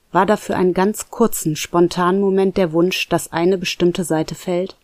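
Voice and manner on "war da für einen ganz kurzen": usual speaking voice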